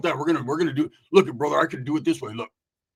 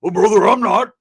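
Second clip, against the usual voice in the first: loud, raised protest; riled-up retort; 4 semitones higher, 9 dB louder than usual. Retold or imitated. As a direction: push back loudly, all worked up. Roach riled up, refuses joke.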